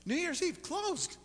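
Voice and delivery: high-pitched disbelief; can't believe it, voice shooting high; incredulous. Real, not acted. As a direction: pitch leaps up in amazed disbelief. Incredulous at churches being closed.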